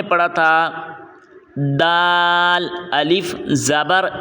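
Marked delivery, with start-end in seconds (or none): 1.8-4.1 الف، زبر spelled letter by letter, very slow; slow spelling out. Shown as a demonstration. Teacher spelling out letters for the class, very slowly. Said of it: "ہجے میں بھی دال کو کھینچنا ہے".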